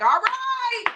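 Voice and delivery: high, bright "All right!"; mock rallying cry. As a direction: snap yourself out of it with a big shout. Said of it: "it's like".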